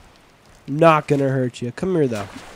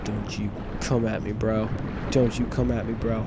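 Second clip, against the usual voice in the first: low, soft mock threat; mock warning; 8 semitones lower, 9 dB quieter than usual. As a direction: warn it off quietly and low.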